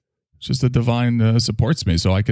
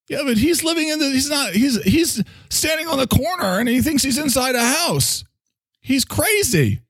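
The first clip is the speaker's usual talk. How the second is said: mocking objector. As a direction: scoff as a know-it-all insisting he's crazy. Voicing an imagined objector.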